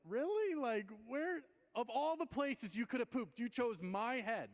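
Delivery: high, loud, incredulous outburst; exasperated disbelief. Retold or imitated. Asking why his head got chosen, exasperated. Retold outburst at bird droppings.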